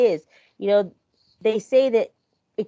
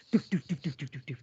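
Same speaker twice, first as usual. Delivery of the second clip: quiet low doof doof of distant running; soft thudding footsteps; 7 semitones lower, 10 dB quieter than usual. Retold or imitated. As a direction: mimic muffled footsteps softly and low. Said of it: "I'd hear something like".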